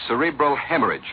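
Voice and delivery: ordinary talk, nothing marked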